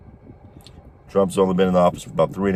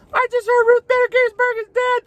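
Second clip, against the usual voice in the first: a hammed-up high-pitched scream of shock, much higher; mock screaming; 3 dB louder than usual. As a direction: imitate a hysterical woman screaming in her car. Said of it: "straight up screaming".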